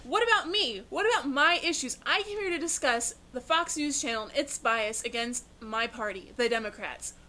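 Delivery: rising frustrated demands; frustrated outburst. Acted. Guest ignored and getting upset.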